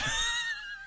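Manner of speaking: high shrieking laugh. Real, not acted. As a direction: burst into shrill laughter. Laughs at chat joke.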